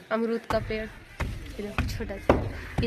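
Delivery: slow hushed whisper over the tiny plant; slow whisper showing off the little guava sapling. Real, not acted. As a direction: breathe it softly, as if not to disturb it. Showing small plant to camera.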